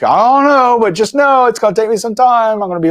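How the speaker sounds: high, loud and flustered; flustered reply. Retold or imitated. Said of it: "And I was like".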